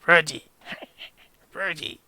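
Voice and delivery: a small growly creature calling; growly little voice. Acted. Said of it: "this sort of growly voice going".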